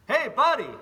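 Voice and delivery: loud, high shout across the patio; calling out. Retold or imitated. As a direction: holler to get a stranger's attention.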